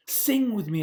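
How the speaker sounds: Freya calls Eliza, calling out over water. Acted. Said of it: "Freya called".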